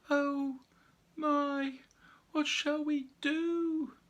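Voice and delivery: a small bear wringing its paws; worried bear voice. Acted. Frightened bear speaks.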